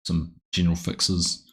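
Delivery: ordinary talk, nothing marked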